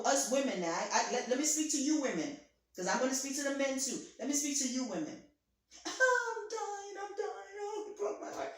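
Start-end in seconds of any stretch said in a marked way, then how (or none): 5.9-8.5 mock a heartbroken woman, overdoing it; theatrical heartbroken wail. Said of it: "sometimes I'm theatrical".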